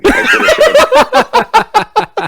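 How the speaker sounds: words breaking into high loud laughter; can't get it out for laughing; cracking up at the bumper sticker. Real, not acted.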